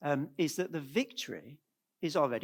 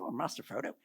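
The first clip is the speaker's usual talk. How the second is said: softly begun attempt at Sam's accent; a tentative hint of Sam's hobbit accent; 3 semitones lower, 8 dB quieter than usual. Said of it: "No, I won't do the accent".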